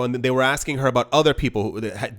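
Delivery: usual speaking voice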